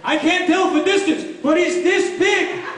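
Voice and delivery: high voice, stretching this big slowly. Retold or imitated. Father telling the driver the distance, high and slow.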